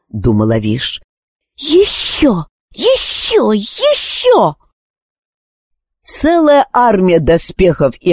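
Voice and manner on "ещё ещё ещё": high, rising insistent repeats; urgent cry. A narrator's reading. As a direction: push harder each time. Wish urging more iron.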